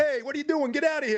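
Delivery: very high hammed-up yell; Philly homeowner. Philly homeowner ordering a salesman off, hammed-up yell. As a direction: chase the salesman off like a cranky Philly local. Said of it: "it's Philly people".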